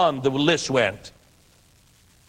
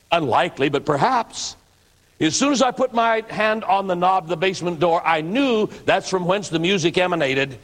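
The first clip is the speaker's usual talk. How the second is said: usual speaking voice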